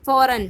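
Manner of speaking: فوراً said as one loud, punched word; teacher stresses فوراً loudly for the class; stressed example word. Shown as a demonstration. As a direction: hit فوراً hard so the class hears it. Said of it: "جیسے".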